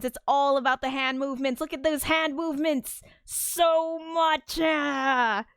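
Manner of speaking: high, theatrical exclaiming; hammed-up melodrama. Hammed-up riff on hand movements.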